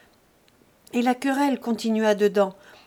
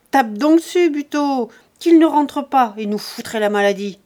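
violent abusive yelling; 2 semitones higher, 7 dB louder than usual. A narrator's reading. Lise screaming abuse at Jean on the doorstep, furious. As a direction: hurl insults, hands on hips. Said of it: "elle gueulait, plus violente, plus injurieuse".

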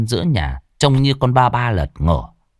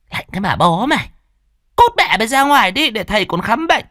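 angry mistress; 13 semitones higher than usual. Acted. Mistress snaps at maid.

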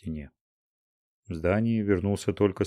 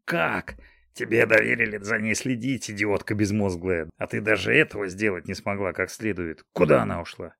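angry scolding. Acted. Old man berates the woman.

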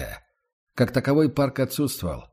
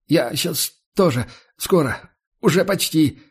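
higher, louder puffing through a gas mask; panting through a mask; 5 semitones higher, 4 dB louder than usual. Acted. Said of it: "пропыхтел сквозь хрюшку Букаш".

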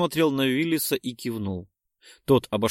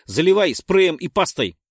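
loud, higher order; urgent command; 7 semitones higher, 8 dB louder than usual. Acted. Willis shouts at the narrator to seal the wound.

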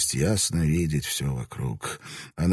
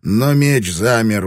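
loud, higher breathless narration; heightened narration; 9 semitones higher, 8 dB louder than usual. A narrator's reading. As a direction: carry the fight's heat into the telling. Narration swept up in fight.

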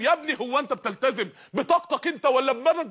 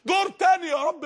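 desperate cry; 6 semitones higher than usual. Acted. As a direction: cry out to be sent back. The damned begging again.

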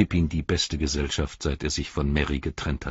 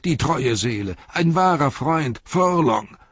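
high, loud, slowed cheering; crowd cheering; 10 semitones higher, 6 dB louder than usual. A narrator's reading. Crowd cheers Forlong, high and loud. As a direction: hail the loyal lord. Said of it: "riefen die Menschen".

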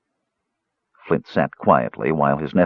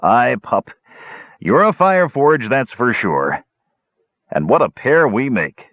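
higher, lively and mirthful; amused dwarf uncle; 5 semitones higher than usual. Acted. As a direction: tease the pup fondly. Flint amused by his nephew.

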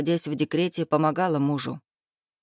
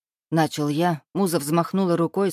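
plain reading voice

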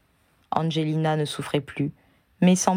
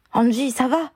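inquiet; 8 semitones higher than usual. A narrator's reading. Harry s'inquiète pour Angelina blessée.

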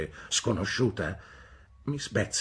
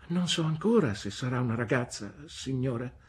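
innocent; 7 semitones higher than usual. A narrator's reading. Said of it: "rispose innocentemente mia madre".

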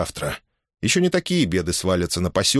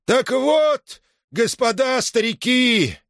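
high, drawn-out cry; shouting to a crowd; 14 semitones higher, slower than usual. A narrator's reading. Arkhip addresses the village elders from the porch, shouting. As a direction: call out slowly, pitched high. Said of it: "закричал".

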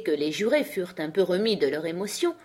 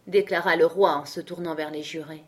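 plain reading voice

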